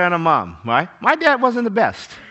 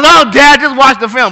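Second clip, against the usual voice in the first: exasperated daughter; 5 semitones higher, 9 dB louder than usual. Retold or imitated.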